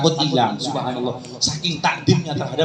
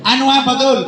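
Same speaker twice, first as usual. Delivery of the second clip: higher-pitched demand for an answer; exasperated kiai; 7 semitones higher, 4 dB louder, faster than usual. Acted. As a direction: press a stammering pupil to speak up. Kiai presses stammering Abdul.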